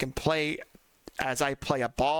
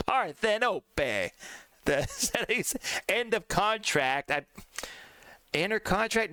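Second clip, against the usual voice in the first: "Parthenope" slow, Italian vowels, final e sounded; lightly Italian "Parthenope" with a drawn-out end; Italian accent; 5 semitones higher, slower than usual. Shown as a demonstration. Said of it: "the best one I can provide".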